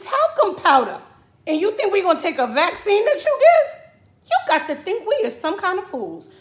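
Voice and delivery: high, loud, incredulous ranting; indignant rant. Real, not acted. Ranting at the vaccine maker, loud and indignant. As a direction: tear into them, disbelieving.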